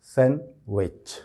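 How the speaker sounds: "सैंडविच" slowly as SAN, vech; slow "सैंडविच" with silent d; slow correct pronunciation. Shown as a demonstration. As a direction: split "सैंडविच" into san and wech, no d. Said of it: "सही किस तरह से बोला जाएगा".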